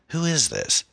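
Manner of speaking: high-pitched, uneasy asking; uncomfortable question. A narrator's reading. Anne to the heavy breather on the phone, uncomfortable. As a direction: ask uneasily, voice lifted high. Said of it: "she asked uncomfortably".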